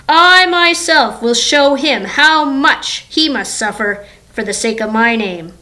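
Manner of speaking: loud, emphatic God voice; God commanding. Acted. The Lord answers Ananias's objection, commanding God voice. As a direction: declare it firmly, leaning on key words. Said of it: "But the Lord said to him".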